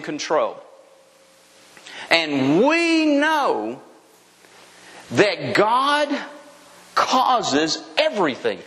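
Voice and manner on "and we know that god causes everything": very slow, emphatic verse reading. Shown as a demonstration. Preacher driving the verse home to believers, very slow and emphatic. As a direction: stretch each phrase out with long pauses. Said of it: "Let me read it again slowly".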